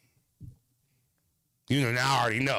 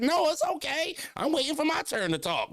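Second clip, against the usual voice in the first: put-on much higher, sweet old lady voice; sweet church lady. The church lady joins the chat in a high sweet voice. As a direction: chirp it politely like a church lady.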